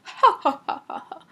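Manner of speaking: chief's amused ha-ha laugh fading to breath; scoffing chief's laughter. Acted. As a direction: laugh it out, then let it die away.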